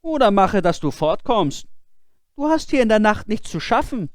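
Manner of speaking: high, loud demand; shouting. Acted. Boy shouting at the silent figure on the stairs. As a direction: order the figure to answer. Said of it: "rief der Junge".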